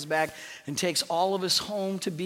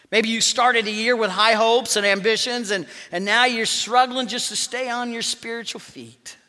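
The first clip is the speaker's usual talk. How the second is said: loud; 5 semitones higher, 7 dB louder than usual. Real, not acted. Preacher building toward appeal.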